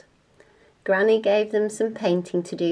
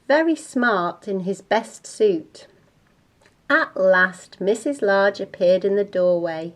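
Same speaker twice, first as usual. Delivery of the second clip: ordinary delivery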